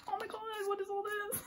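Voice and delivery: high squeaky amazed voice; delighted surprise. Real, not acted. Discovering photobook extras.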